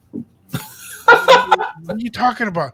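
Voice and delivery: slow, loud, high mock dad. Dad scolding his fast-talking son, slow loud mock. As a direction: stretch it out like a baffled father. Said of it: "my dad would be like".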